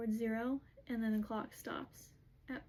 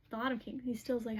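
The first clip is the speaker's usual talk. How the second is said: normal talking voice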